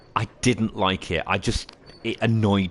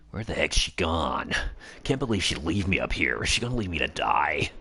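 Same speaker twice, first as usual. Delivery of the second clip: grumbling Billy voice; 4 semitones lower, 4 dB quieter than usual. Retold or imitated. Voicing teammate Billy waiting.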